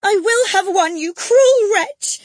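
very high, slow screaming fury; girl screaming at nurse burning her letters. A narrator's reading. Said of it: "she screamed".

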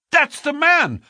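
high, urgent gasp of recognition. A narrator's reading. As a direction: blurt it out breathlessly. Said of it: "I gasped".

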